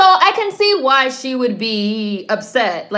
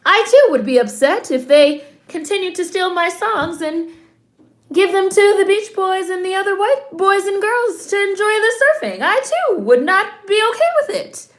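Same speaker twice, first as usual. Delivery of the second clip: sarcastic; 11 semitones higher, 4 dB louder than usual. Pretend. Mocking echo of Etta's complaint.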